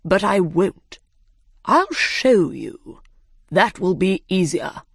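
lightly voiced, deliberate dodo; unhurried dodo, stretching the words; dodo character voice. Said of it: "said the dodo".

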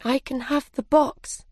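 put-on understated little-girl sadness; sad. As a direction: answer with a touch of disappointment. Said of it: "said Alice sadly".

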